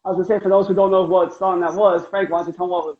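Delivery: ordinary delivery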